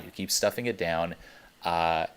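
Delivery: usual speaking voice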